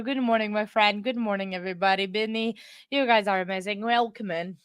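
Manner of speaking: ordinary talk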